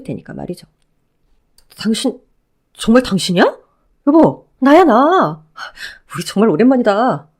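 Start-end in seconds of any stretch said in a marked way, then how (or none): 1.8-7.3 lightly voiced ex-husband, eager and pleading; a hint of a clingy ex overjoyed to see her. Ex-husband calling out to his ex-wife, lightly voiced eager pleading.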